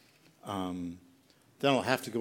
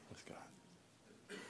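whispered; 9 semitones higher, 25 dB quieter than usual. Real, not acted. Pastor closing a thought on being present with God, whispered.

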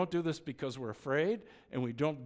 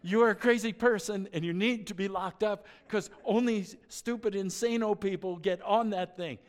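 higher and louder mock rant at the friend; exasperated outburst; 6 semitones higher, 6 dB louder than usual. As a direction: tell him off, half-shouting in disbelief. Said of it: "And you're going".

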